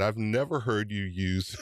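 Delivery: ordinary delivery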